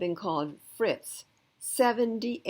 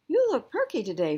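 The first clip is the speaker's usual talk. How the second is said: higher and louder, chirpy morning greeting; cheerful mother; 7 semitones higher, 8 dB louder than usual. Acted. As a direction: brighten it like a sunny mom.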